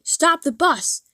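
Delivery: high, loud shout to the driver; desperate passengers shouting at the driver. Retold or imitated. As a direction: yell it to make the bus stop. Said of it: "passengers started shouting".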